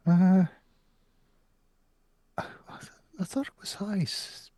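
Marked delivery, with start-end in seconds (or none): 2.4-4.5 stunned whisper. Acted. Simon stunned realization.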